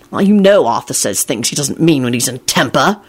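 usual speaking voice

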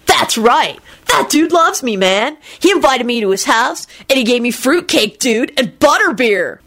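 high, excited dude voice; swooping, gleeful enthusiasm; happy dude. A narrator's reading. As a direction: boast happily about your pal. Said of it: "Kenny replied happily".

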